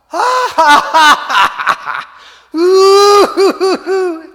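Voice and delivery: high, loud evil cackle; Satan's evil laughter. Acted. Satan laughs at Jesus from hell, loud high evil cackle. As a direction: cackle like the devil delighted. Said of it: "Satan burst out laughing himself".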